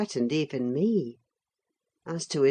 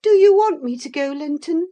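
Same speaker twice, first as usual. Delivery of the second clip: quick, high, louder plea; doleful young lady; 11 semitones higher, 10 dB louder than usual. A narrator's reading. Catherine asks whether Linton wants her gone, high pleading. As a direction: press him in a high girl's voice. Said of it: "asked Catherine dolefully".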